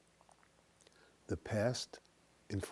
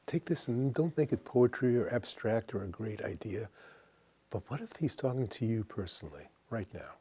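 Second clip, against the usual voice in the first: normal talking voice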